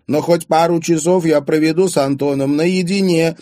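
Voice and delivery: high, loud defiant cry; shouting. A narrator's reading. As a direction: shout back over a shut door. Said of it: "крикнула". (громко, с вызовом)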